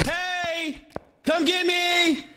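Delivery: high, slow call to the ghost; high taunting call. Pretend. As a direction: sing it out slowly to lure her.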